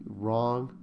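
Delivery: normal talking voice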